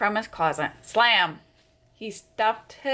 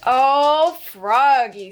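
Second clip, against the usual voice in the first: exasperated oh; 3 semitones higher, 6 dB louder, much slower than usual. Acted. Mother sees the mess.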